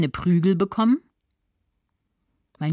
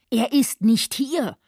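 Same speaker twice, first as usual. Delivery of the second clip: higher, slow, insistent denial; frantic outcry; 7 semitones higher, slower than usual. A narrator's reading. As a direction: deny it, beside yourself but without full shouting. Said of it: "schrie Claudia außer sich". (außer sich, gehobene Stimme)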